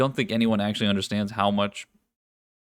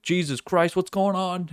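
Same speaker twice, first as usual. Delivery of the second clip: higher-pitched mock alarm; mock panic; 10 semitones higher than usual. Bystander caught in a Times Square stampede, mock panic.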